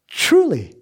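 Jesus proclaiming "Truly". Acted. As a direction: ring out the word like a pronouncement. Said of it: "Jesus says what?".

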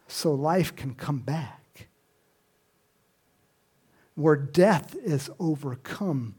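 ordinary delivery

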